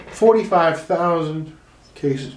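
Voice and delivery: drawn-out, weighty delivery; slow, grave announcement; slow dramatic reading. Shown as a demonstration. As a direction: let each word land slowly. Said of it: "Go back".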